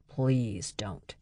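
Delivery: soft pleading; begging. Acted. Berg begging Britta, soft pleading. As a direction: plead with her to stop. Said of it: "Berg suddenly begged".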